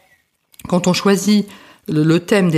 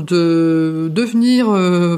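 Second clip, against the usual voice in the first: ordinary delivery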